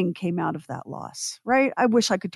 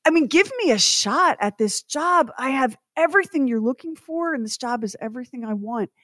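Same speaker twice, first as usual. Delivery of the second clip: higher-pitched, exasperated plea; frustrated pleading; 6 semitones higher than usual. Retold or imitated. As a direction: plead your case, crushed and indignant. Voices crushed, pleading applicant.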